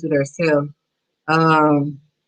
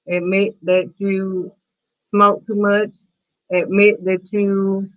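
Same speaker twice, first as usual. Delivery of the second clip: normal talking voice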